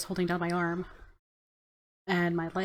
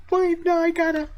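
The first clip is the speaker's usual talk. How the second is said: high and loud through giggles; words swallowed by a loud high laugh; laughing helplessly; 11 semitones higher, 6 dB louder than usual. Acted. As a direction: try to talk but keep cracking up. Cracking up mid-sentence.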